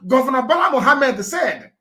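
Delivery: normal talking voice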